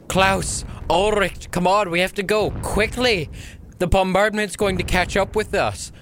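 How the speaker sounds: high, urgent shout under bombardment; rushed. Acted. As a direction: yell to comrades to hurry. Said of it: "said in a very rushed voice".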